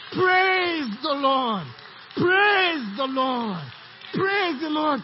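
slow, loud drawn-out chant; full-force call to worship; preaching praise. Real, not acted. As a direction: lead the congregation in praise. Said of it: "Can you say it with me?".

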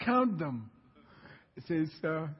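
normal talking voice

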